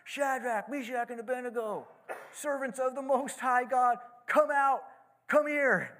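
high, loud calling out, building to a yell; shouted. A narrator's reading. King shouting into the furnace door, calling loudly. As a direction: call them out of the furnace at full voice. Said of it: "and he shouted".

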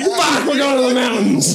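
loud, high, fed-up cry; exasperated shout. Pretend. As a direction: yell it in frustration. Mock complaint shout.

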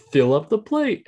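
high, put-on voice barking an order; high mock demand. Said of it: "and he's like".

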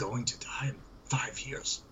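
breathy whispered repeat, all unvoiced; hushed whispered warning. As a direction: whisper the doom line. Whispered retake of same line.

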